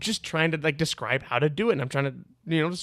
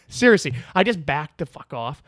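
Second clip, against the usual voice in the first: normal talking voice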